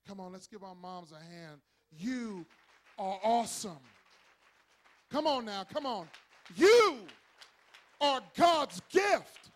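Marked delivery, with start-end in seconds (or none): 1.9-9.5 loud, high, stretched-out praise shouts; rally the church with big ringing cries; preaching at full force. Acted. Pastor shouting praise to the moms in church.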